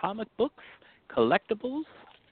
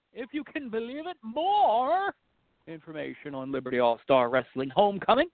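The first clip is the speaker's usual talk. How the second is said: big hype voice; 3 semitones higher than usual. Acted. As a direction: hype the bloodthirsty crowd, evil edge. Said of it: "That's my big hype voice".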